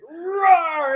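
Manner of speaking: high, drawn-out imitated roar; mock roar. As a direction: roar playfully like laying down the law. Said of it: "putting down the law".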